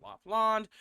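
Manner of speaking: "Lapland" with "-land" as "lahnd"; popular Lapland pronunciation. Shown as a demonstration. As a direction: rhyme the end of "Lapland" with "pond". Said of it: "Everyone calls it Lapland".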